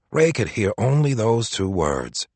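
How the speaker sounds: ordinary delivery, nothing marked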